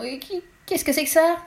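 high, frightened stammering question; trembling stammer. A narrator's reading. Antoine asking Lou about the coffins, trembling stammer. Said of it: "Il a bredouillé tout tremblant".

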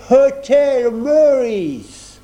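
put-on stiff, solemn Englishman in a thick English accent. A narrator's reading. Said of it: "prit un accent anglais très prononcé et très solennel". (accent anglais très prononcé et solennel, très lent)

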